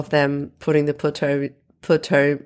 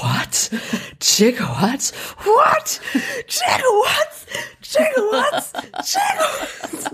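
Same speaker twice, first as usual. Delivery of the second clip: very high-pitched mock freak-out; escalating squeal, breaking into laughter; Christopher Lloyd; slower than usual. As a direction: imitate a frantic scientist shrieking in disbelief. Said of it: "And Christopher Lloyd's like".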